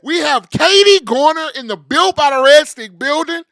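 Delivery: high, loud build to shout; hype announcement. Acted. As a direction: shout her in like an arena MC. Hype announcing guest arrival.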